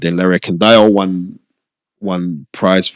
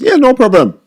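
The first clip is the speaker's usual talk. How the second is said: breezy brother voice; 5 dB louder than usual. Retold or imitated. Brother's casual reply, acted quote.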